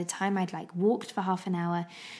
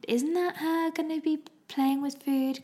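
slower, higher mock voice of a doubting husband; 8 semitones higher than usual. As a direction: imitate him as slow and clueless. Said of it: "And Hendrik was like".

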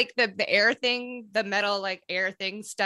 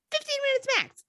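sudden high squeaky voice; high squeak; faster than usual. Acted. Mock high squeak on max.

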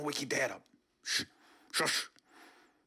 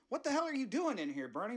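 higher shocked question; 10 semitones higher than usual. Acted. Host finding the intruder, higher and shocked. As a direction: demand it, startled.

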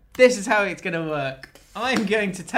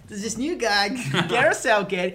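higher, camp American whine; American accent; 4 semitones higher, 3 dB louder than usual. Acted. Player griping about minutes under new manager, camp American accent. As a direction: complain in a hammed-up American accent, pitched up. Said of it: "through that American accent".

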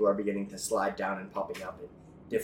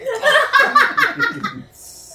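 high, loud evil cackle; maniacal laugh; 14 dB louder than usual. Acted. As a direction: throw your head back and cackle. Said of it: "maniacal laughing".

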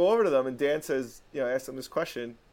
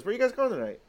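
guy's casual question; 9 semitones higher, faster than usual. Retold or imitated. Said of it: "And he goes".

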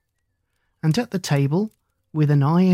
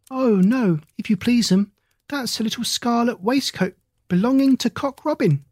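higher, humble hedgehog washerwoman voice; Mrs Tiggywinkle; 7 semitones higher than usual. A narrator's reading. Hedgehog washerwoman answering Lucy politely, higher humble voice. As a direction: bob a curtsy with the words, high and polite.

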